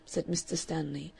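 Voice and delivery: plain reading voice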